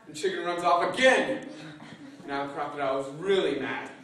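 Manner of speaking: ordinary delivery, nothing marked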